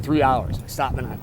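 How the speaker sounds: ordinary talk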